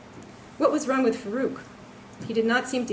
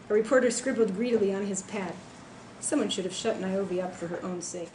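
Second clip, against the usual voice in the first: ordinary delivery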